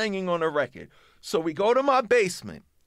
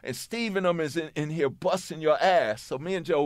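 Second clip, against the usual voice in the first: ordinary delivery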